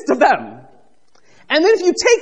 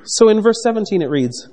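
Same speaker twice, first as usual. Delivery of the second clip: ordinary talk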